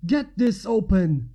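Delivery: loud bang-on-the-door yell; shout. A narrator's reading. Soldier at the door to comrades: loud shout. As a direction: bark it through the door. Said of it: "a man shouted".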